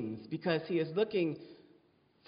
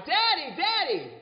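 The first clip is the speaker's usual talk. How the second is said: high, louder mock cry of a hurt child; imitated child's cry; 11 semitones higher, 4 dB louder than usual. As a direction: call out for Dad like a hurt little girl. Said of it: "hurt herself and she cried".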